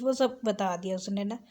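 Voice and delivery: ordinary talk, nothing marked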